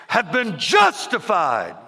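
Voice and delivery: loud, drawn-out preacher cry; yelling preacher. Retold or imitated. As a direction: punch it out slowly like a revival preacher. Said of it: "the more he yelled".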